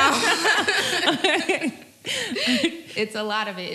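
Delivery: amused laughing drawl. Real, not acted. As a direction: laugh at how much there is. Laughing over the gossip.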